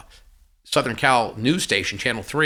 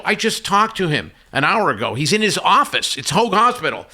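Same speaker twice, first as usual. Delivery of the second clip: higher, insistent retold protest; reenacted stunned denial, pitched up; shocked disbelief; 7 semitones higher, 4 dB louder than usual. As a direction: refuse to believe it, voice climbing. Retelling his disbelief at the news.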